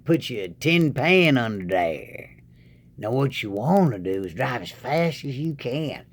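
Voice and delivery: lightly voiced Southern good ol' boy; a hint of a drawling country fool. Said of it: "you've probably seen a couple tricks".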